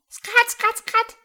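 shrill, very high, loud quacking laugh; duck cackle. A narrator's reading. Ducks grinning at the turkey's joke, with a high loud cackle. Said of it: "grinsten alle Enten".

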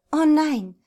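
high, loud dismay; sudden panicky exclamation; alarmed cry. A narrator's reading. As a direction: cry out as trouble heads your way. Moira alarmed as Kevin approaches.

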